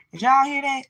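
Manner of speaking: high, loud disbelief; ranting vlogger asks viewers, loud and incredulous. Real, not acted.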